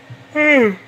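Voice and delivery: long loud tired yawn. Real, not acted. Tired late-night gamer yawning into the mic. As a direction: let out a big yawn mid-sentence. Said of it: "Sorry, yawn".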